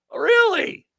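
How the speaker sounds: high squeaky "really?" dropping away; sarcastic disbelief. Acted. Repeat of the mock disbelief.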